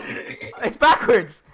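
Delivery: said through a drawn-out laugh. Real, not acted. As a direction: laugh it out slowly and high. Laughing at his own injury.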